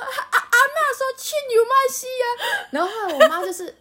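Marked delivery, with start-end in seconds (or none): 0.1-2.3 mock crying. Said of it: "他一边哭说".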